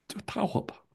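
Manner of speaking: a high unvoiced whisper, the son giving in; whispered plea. Son whispering to his father to strike him, hushed. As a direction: breathe it out like a scared boy bracing for the blow.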